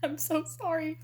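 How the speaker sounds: breaking into laughter; real laughing, half crying. Real, not acted. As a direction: giggle the apology. Laughing at her own embarrassment.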